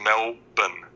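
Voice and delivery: Melbourne said slowly as "MEL-bun"; slow, separated "Mel-bn" for Melbourne, R dropped. Shown as a demonstration. Listener re-teaching the host after a slip, no R. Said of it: "No, there's no R".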